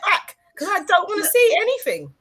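high-pitched mock-indignant outburst; mock outrage. Host to friends going on holiday, mock outrage. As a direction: scold them in exaggerated protest.

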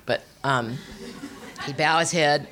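ordinary talk

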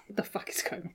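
whispered disbelief. Real, not acted. Baffled aside.

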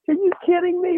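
high-pitched incredulous exclamation; retold disbelief. Teller's astonished reaction, exclaimed.

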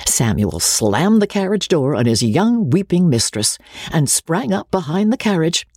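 usual speaking voice

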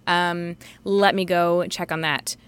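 normal talking voice

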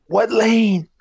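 high, loud triumphant yell; player's yell. Acted. Basketball star yelling his catchphrase after a basket. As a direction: shout it like a star after scoring.

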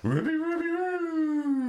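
very high cartoon dog howl; Scooby-Doo. Quoting an actor's defining role, cartoon dog voice. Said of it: "Scooby-Doo".

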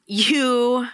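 ordinary delivery

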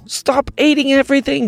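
put-on high, pleading child; pleading shout. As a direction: beg it to stop. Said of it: "said Jack".

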